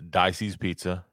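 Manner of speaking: ordinary delivery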